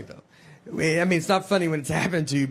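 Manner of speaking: ordinary delivery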